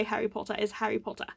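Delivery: quick mock-British name; a hint of fake British; fake British accent. Real, not acted. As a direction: lightly put on a bad British accent. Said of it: "that crappy fake British accent".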